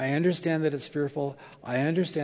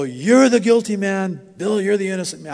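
mock proclamation; 5 semitones higher, 9 dB louder than usual. Preacher voicing everybody siding with him, mock proclamation. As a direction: proclaim it like a triumphant verdict.

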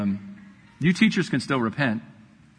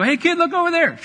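sneaky distraction call; 13 semitones higher, 4 dB louder than usual. Pretend. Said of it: "You walk over and go".

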